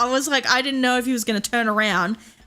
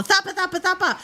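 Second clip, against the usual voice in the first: high-pitched retold panicked calling; freaking out; 9 semitones higher, faster than usual. Owner retelling calling Thumper off the toad, mock panic. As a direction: mimic frantically calling the dog back. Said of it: "I start freaking out".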